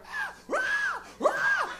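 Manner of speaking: put-on very high kid shrieks; schoolyard screams. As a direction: imitate shrieking children at recess. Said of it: "That sound".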